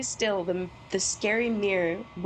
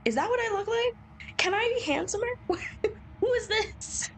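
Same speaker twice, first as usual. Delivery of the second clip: much higher, laughing alarm; high startled voice; 12 semitones higher than usual. Real, not acted. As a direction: squeak it in fright.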